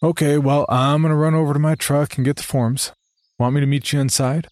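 high, overly cheery nervous voice; nervous cheerful. Acted. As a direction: fake brightness to escape fast. Nervous eager reply to host.

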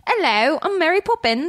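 high-pitched mock British nanny; playful storybook English governess; Mary Poppins. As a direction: put on a lilting, high British voice. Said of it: "I sound like Mary Poppins".

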